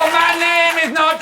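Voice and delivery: loud, high protest; indignant shout. Acted. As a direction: protest loudly. Indignant outburst, later redone.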